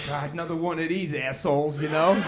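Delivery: low, quiet, fed-up aside; weary nurse aside. Retold or imitated. Nurse grumbling about another clueless dad, low and quiet. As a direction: sigh it out like a tired nurse.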